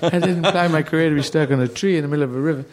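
a hint of self-pity, fast; mock stuck artist. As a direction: play the stranded comic, lightly. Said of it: "tree and going".

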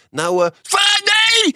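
stammering, then a sudden high, loud cry of no; startled shout. Retold or imitated. Mocking a stranger on the street, stammer then a loud high yelp. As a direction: flinch into a shrill nee. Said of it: "dan zegt diegene".

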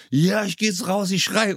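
high-pitched, psyched-up retold outburst; fired-up Basti. Teammate psyching himself up to go out, imitated excitedly. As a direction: imitate a teammate suddenly pumped up, pitch jumping up. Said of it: "auf einmal war so Basti so".

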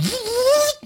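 high imitated record scratch; vocal rip of a needle across vinyl; record rip noise. Host showing the awkward halt after the gaffe, imitated record scratch. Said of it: "you can hear the record rip".

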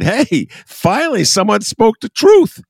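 cheering that someone finally said it; excited retort. Retold or imitated. Retelling his own reaction, loud excited cheer. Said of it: "And I said".

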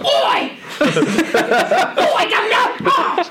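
high, hammed-up Cockney yelps; mock Cockney. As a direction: squeal it like a Cockney who got punched. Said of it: "he just turns Cockney when he gets punched".

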